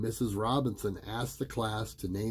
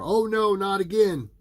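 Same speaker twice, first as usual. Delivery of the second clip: higher, louder oh no; mock dismay; 10 semitones higher, 7 dB louder than usual. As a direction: ham up the dread.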